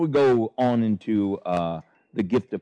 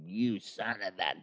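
hammed-up accusing tirade, pitch climbing; mock rebuke; 3 semitones higher than usual. Mocking how Jesus did not rebuke Judas, overblown outrage. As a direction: act out a public denunciation, rising high in mid-rant. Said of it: "even in rebuking and stuff like that".

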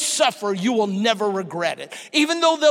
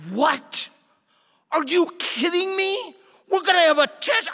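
higher, faster, outraged disbelief; can't believe there's a test; shocked disbelief; 6 semitones higher than usual. Retold or imitated. Student hearing of a surprise test, shocked and higher.

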